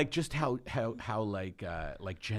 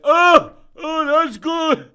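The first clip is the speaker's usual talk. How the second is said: very high, very loud cry of approval; mock ecstatic yell; 17 dB louder, much slower than usual. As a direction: shout it in exaggerated amazement. Mock mass reaction to good stuff.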